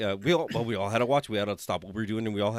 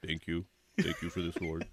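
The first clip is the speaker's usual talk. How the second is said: mumbled shy thanks, much softer and lower; 5 semitones lower, 7 dB quieter than usual. Retold or imitated. Shy veteran accepting his award, quiet mumble. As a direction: mumble it bashfully. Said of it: "all shy".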